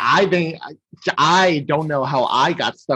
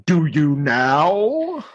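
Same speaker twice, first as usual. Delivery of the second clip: very slow, sceptical dragon; Smaug; much slower than usual. Acted. Smaug doubting the burglar's flattery, slow drawn-out question. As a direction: stretch the question out menacingly. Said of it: "Smaug wakes up".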